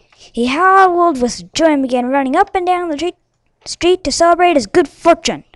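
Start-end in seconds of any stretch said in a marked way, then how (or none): none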